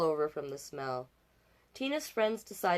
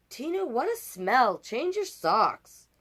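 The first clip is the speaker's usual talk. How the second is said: put-on kids complaining; 6 semitones higher, 6 dB louder, slower than usual. Kids complain about smell.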